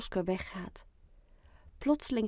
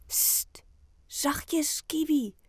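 hushing whisper; 7 semitones higher, much slower than usual. Acted. Hushing hidden pet in class.

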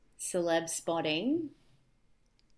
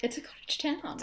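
whispered echo, higher and unvoiced; mock whisper; 8 semitones higher than usual. Echoing her co-host about Surrey in a mock whisper. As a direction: hush it like a secret.

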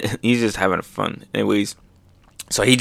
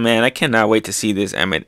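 normal talking voice

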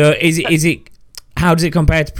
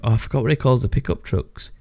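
normal talking voice

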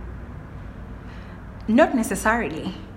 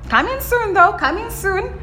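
teasing promise; 10 semitones higher than usual. Real, not acted.

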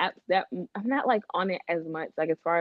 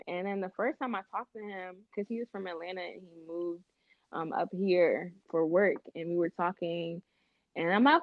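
ordinary delivery

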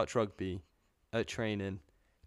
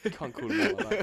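laughing through the words; words broken by laughter; 11 semitones higher, 4 dB louder than usual. Real, not acted. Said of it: "I'm fucking crying".

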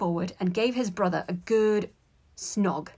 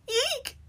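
tiny high-pitched squeal; alarmed eek. A narrator's reading. Boy alarmed as the bell rings, high squeak. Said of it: "Colin said to himself".

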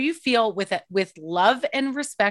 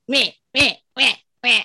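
mocking nag noises; 3 semitones higher, 6 dB louder than usual. Mocking nagging parents.

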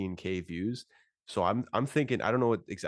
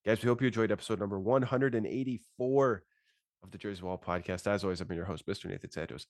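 ordinary talk, nothing marked

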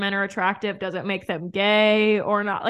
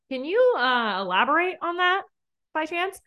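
mock polite; 8 semitones higher than usual.

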